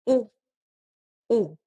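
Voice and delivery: U as a pure oo; Spanish vowel. Shown as a demonstration. Teacher modelling vowels, Spanish pronunciation. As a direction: say U as a short oo. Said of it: "this one is U".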